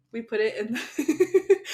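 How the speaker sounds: slow words through laughter; laughing. Real, not acted. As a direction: laugh while saying it. Laughing mid-sentence.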